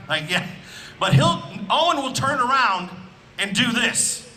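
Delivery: ordinary talk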